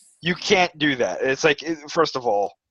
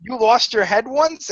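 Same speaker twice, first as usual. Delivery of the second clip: higher, incredulous question; mock surprise; 7 semitones higher than usual. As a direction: act out the companion catching on. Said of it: "She'll be like".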